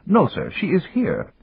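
high, prim servant voice; a dutiful mechanical butler; polite butler robot. Acted. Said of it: "The butler robot trundled up".

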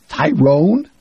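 high, loud incredulous question; surprised. Acted. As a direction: echo the name in disbelief. Jamie surprised by the name.

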